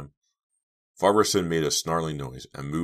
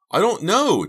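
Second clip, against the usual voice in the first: high, loud scream of terror; terrified scream; 10 dB louder than usual. Acted. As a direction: scream it in panic. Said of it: "Dina screamed".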